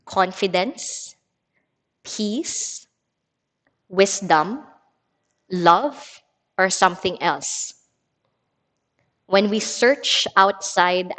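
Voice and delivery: unhurried reading with long pauses between phrases; meditative card reading. Acted. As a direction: let each listed word stand alone, then pause. Reads card slowly to class.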